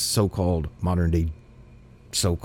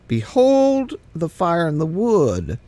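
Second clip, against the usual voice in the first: Isaac's voice; 6 semitones higher than usual. Acted. Isaac's question voiced in reading.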